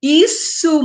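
usual speaking voice

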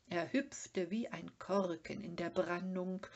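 plain reading voice

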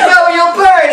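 high, hammed-up cry of warning; mock alarm. Pip warning Miss Havisham of fire, mock alarm. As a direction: cry out in exaggerated panic.

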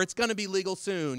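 high, loud mock enthusiasm; hammed-up gleeful empty nester; empty-nester parent. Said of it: "empty nesters ... who are like".

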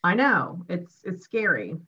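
ordinary delivery

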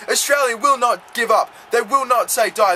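ordinary delivery

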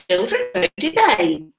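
ordinary delivery, nothing marked

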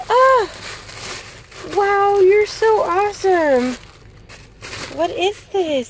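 high, loud, gushing delight; thrilled squeal. Real, not acted. Thanking her friend for the gift, loud high gushing delight. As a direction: squeal with joy then gush slowly.

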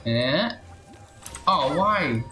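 ordinary talk, nothing marked

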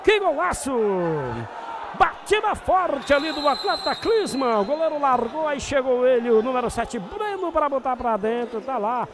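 loud, high excited recap; explosive goal commentary. Real, not acted. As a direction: keep the goal euphoria going. (narração eufórica do gol)

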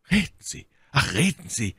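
imploring, pitch jumping up at first; drängender Ausruf. A narrator's reading. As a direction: beg her to speak. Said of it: "rief Felton". (flehend gerufen)

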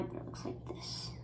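whispered repeat of it looks like this; said again in a hush. Shown as a demonstration. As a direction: drop to an unvoiced whisper. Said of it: "whispered take".

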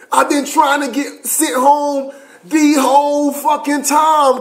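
high, slow, loud hammed-up pleading; begging wail. Private begging the sergeant to send him home, wailing. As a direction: whine to be sent home. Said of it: "I said".

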